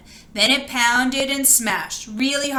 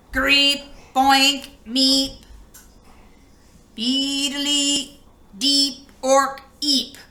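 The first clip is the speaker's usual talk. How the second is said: robot beeps; 2 semitones higher, much slower than usual. Acted. Robot noises in story.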